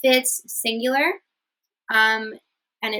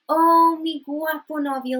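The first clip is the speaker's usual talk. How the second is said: high, gushing "Oh", then stressed "guapo"; loud drawn "Oh" leading into a high "guapo"; emphasis on guapo; 6 semitones higher, 4 dB louder than usual. Shown as a demonstration. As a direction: swoon over "guapo". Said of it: "You're emphasizing on the handsome".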